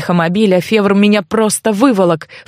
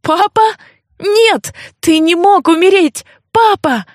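high-pitched, disbelieving daughter's cry; stricken, much higher voice; anguished cry; 9 semitones higher than usual. A narrator's reading. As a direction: cry out high in shock and grief. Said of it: "Левентия вскрикнула".

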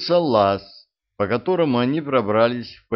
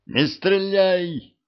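high slow urgent shout; 10 semitones higher, much slower than usual. A narrator's reading. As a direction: yell it to stop the shot. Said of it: "успел крикнуть Мальчевскому".

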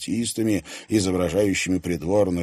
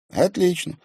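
pleased; 12 semitones higher, 4 dB louder than usual. A narrator's reading. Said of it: "обрадовался Тимофей".

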